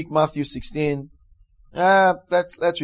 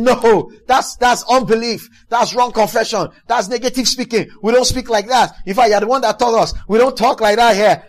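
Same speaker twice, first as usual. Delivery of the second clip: loud, higher scolding of a friend; reprimand; 5 semitones higher, 6 dB louder than usual. Acted. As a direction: rebuke them sharply, voice raised. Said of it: "the person begins to reprimand you".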